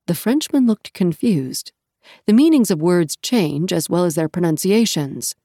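ordinary delivery

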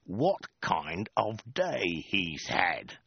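very slow, every word spelled out; hissed threat. A narrator's reading. Soldier with sword at her throat, slow hissed menace. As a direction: force it out word by word. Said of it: "he hissed".

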